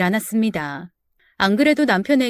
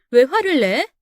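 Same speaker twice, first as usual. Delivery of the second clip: angry demand; 4 semitones higher than usual. Acted. Wife snapping at her husband in a heated argument, angry. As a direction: throw the question back at him hard.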